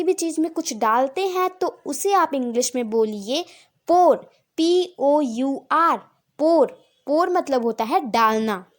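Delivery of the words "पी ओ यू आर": slow spelling. Shown as a demonstration. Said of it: "teacher spells the word".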